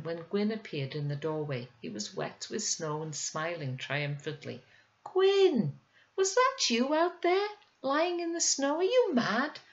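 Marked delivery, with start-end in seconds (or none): 5.0-9.6 high, alarmed mother scolding her son; a mother startled into scolding, pitched high. Acted.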